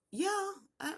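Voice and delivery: high-pitched answer through a door; calling back. Retold or imitated. Answering staff through bathroom door.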